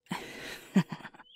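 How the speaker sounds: short breathy laugh; light chuckle. Acted. Partners bantering in bed, light breathy chuckle.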